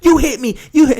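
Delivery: high, loud mock outrage. Passenger accusing the driver on camera, high mock outrage.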